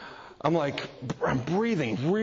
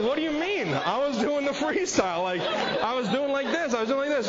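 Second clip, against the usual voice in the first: higher, incredulous protest, laughing; indignant surprise; 8 semitones higher than usual. Retold or imitated. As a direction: act baffled that she'd question your stroke. Defends his swimming to his wife.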